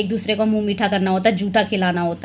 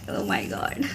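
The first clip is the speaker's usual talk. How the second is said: breathless low whisper; whispered oh my God; 12 semitones lower, 8 dB quieter, much slower than usual. Real, not acted. Seeing her brother cry in the photo, a whisper. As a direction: whisper it under your breath.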